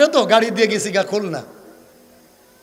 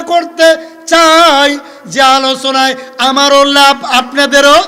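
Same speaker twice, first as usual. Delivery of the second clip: high, sustained preacher's sing-song; measured chanting to a congregation; intoned sermon teaching; 7 semitones higher, 8 dB louder, slower than usual. Acted. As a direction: announce each point on a held note.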